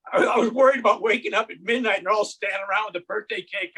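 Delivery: normal talking voice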